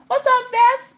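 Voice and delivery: squeaky high-pitched hello to a viewer; high greeting voice. Acted. Streamer greeting a chat viewer, squeaky high voice. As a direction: chirp the viewer's name way up high.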